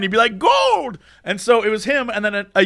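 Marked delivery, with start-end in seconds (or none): 0.4-1.0 high, excited prospector cry; old gold miner. Acted. Bearded old miner digging in a mine, high mock cry. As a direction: cry out like a prospector striking it rich. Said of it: "If he was digging in a mine".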